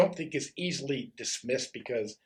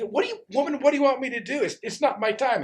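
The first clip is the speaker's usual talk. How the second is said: resisting Jesus; 11 semitones higher, 5 dB louder, faster than usual. Acted. Jesus resisting Mary again.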